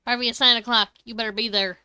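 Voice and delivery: loud, bossy demand; mock order. Streamer ordering the doctor character, loud bossy demand. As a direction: call him out loudly like a bossy boss.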